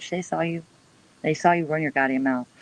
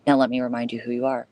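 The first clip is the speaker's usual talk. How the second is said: normal talking voice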